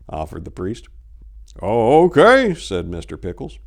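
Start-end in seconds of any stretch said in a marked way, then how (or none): 1.6-2.5 put-on old husband, a little higher and louder; a hint of a puzzled old man; lightly voiced old man.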